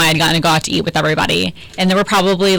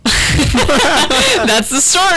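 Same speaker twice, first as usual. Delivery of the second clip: laughing; 8 semitones higher, much slower than usual. Real, not acted. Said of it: "Let me jot that one down".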